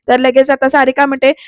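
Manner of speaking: usual speaking voice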